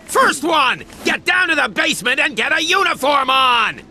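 high, angry shouted command; barked order. Acted. Chief orders new officer into uniform, barking. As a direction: bellow it at a subordinate.

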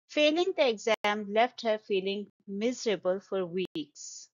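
ordinary talk

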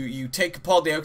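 ordinary talk

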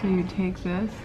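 quietly explaining, low and soft; hushed library voice. Real, not acted. Instructing child quietly in library.

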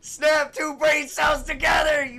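loud, high cracking yell; furious scream. Real, not acted. Gamer screaming at teammates to use their brains, furious. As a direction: scream at the idiots.

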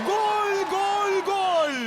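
screaming football commentator. Acted. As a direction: shout gol three times. Goal call in football game.

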